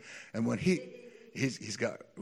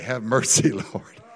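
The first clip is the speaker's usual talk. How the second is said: laughing plea; 11 dB louder than usual. Real, not acted. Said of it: "I would not normally talk like that".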